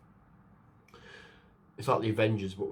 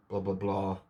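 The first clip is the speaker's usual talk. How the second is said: ordinary talk, nothing marked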